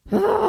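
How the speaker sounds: loud high exasperated ugh; exasperated groan. Real, not acted. Podcaster fed up with tipping questions, loud ugh. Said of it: "exasperated groan".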